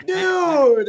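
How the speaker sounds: high, loud, drawn-out greeting; hammed-up call to a long-lost buddy; excited friend's holler. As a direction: imitate an overjoyed shout across a crowd.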